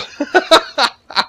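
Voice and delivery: high laugh. Real, not acted. Laughing at own impression.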